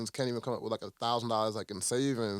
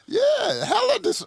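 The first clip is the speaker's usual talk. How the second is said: much higher, louder mock voice, cut off; mock squeaky outrage; 7 dB louder, slower than usual. Mocking a customer's outrage in a much higher, louder squeak.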